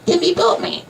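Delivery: very high squeaky pet talk; dog voice. Acted. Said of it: "I call him Boss Man when I say".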